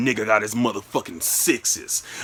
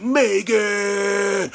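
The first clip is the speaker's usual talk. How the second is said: high, drawn-out call of a name; 9 semitones higher, 4 dB louder than usual. Retold or imitated. Man hearing girlfriend at the door, calling out. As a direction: sing the name out across the house. Calls out as she walks in.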